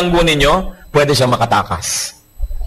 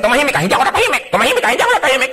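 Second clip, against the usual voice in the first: mock quarrel; 9 semitones higher, much faster than usual. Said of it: "Pero kadalasan kasi".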